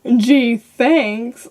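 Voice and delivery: retold dry, sarcastic thanks. Child answering mother's creepy remark, mock-grateful.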